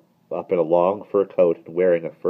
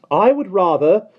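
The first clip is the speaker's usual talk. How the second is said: high, loud, disdainful nanny; sniffy; 10 dB louder than usual. A narrator's reading. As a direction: sniff with scorn. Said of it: "she remarked with a sniff".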